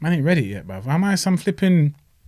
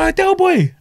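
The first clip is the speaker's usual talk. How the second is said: high, delighted call of his name; excited greeting; 14 semitones higher, slower than usual. Retold or imitated. Fan spotting a boxer at the lights, high excited greeting. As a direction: greet the celebrity with a thrill. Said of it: "I was like".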